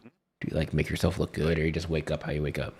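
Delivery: normal talking voice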